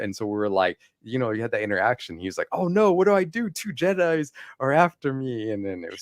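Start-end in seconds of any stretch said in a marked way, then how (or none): 2.5-5.5 high, hammed-up fake fright; a dark side fan hamming up his terror; mock panic. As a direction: squeal it like a cornered villain playing scared. Villain mock-panics at two Jedi.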